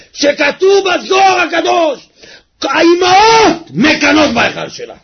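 high crying shout; full-force sermon climax; impassioned preaching. Acted. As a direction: cry it out to the crowd.

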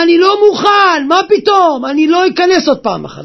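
high, loud protest; loud, high-pitched outburst; acted shouted refusal. Acts the man's flat refusal.

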